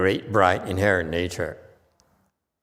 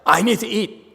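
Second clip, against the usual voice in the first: mock craving; 11 semitones higher, 5 dB louder than usual. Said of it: "If it's like".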